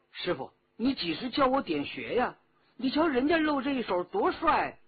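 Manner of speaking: excited pleading, rising high and loud; keen, high-pitched youthful eagerness; eager pupil. Acted. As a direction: pester the master with admiring enthusiasm. Pupil eagerly begs master to teach.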